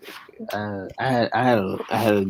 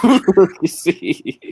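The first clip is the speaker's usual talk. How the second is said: laughing, raised-pitch confession; laughing admission; 8 semitones higher, 4 dB louder than usual. Real, not acted. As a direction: say it through laughter, pitched higher. Cracks up while admitting it.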